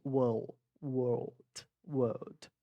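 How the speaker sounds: WORLD with R dropped, lightly touched L; British, silent R. Shown as a demonstration. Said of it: "A mesma coisa vai acontecer nessa palavra". (WORLD britânico, comendo o R)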